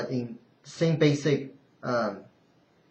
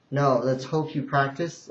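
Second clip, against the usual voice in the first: normal talking voice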